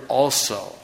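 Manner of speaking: "also" drawn out, slow and falling; "also" set apart, low and deliberate; stress on also. Shown as a demonstration. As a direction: lean on the word "also". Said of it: "and the word".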